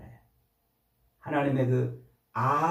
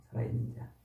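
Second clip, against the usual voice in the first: a soft whispered repeat; whispered echo; 7 semitones lower, 10 dB quieter, faster than usual. Real, not acted. Pastor echoing the phrase to the congregation in a whisper. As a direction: let it fall to a hush.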